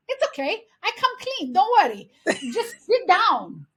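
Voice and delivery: put-on high, loud broken-English accent; mad aunt, immigrant accent. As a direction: impatiently brush them off in a heavy immigrant accent. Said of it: "she was getting so mad at them".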